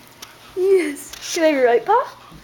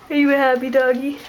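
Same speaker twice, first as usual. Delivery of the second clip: normal talking voice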